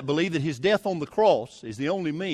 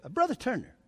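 higher-pitched sarcastic address; mock sarcasm, pitched up; 6 semitones higher, 3 dB quieter than usual. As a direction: imitate a sneeringly sarcastic rival minister. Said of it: "very sarcastically said".

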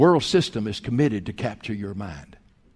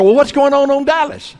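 higher and louder, hammed-up eager nosiness; over-eager question; 12 semitones higher, 9 dB louder than usual. Preacher replaying his nosy question to the cashier, hammed-up eager. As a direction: repeat it, even more worked up and keen. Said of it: "like somebody that had lost their mind".

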